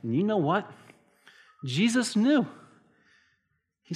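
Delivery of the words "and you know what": ordinary talk, nothing marked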